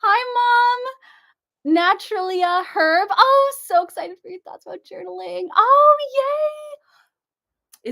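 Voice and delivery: high, loud gushing hello; squealing happy welcome; excited greeting. Real, not acted. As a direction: burst in delighted to see everyone. Excited greeting opening live.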